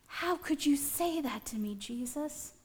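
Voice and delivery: hurt question. Acted. Hurt Peter answers Jesus.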